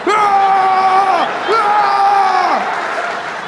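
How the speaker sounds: high, drawn-out attacking yell; hammed-up war cry, held long; long mock battle scream. America attacks a sandcastle, long mock battle scream.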